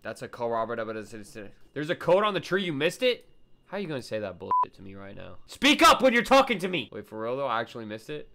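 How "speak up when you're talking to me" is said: high, loud scolding; outraged yell; indignant demand. Real, not acted. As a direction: shout it as an order to chat. Scolds chat in mock outrage.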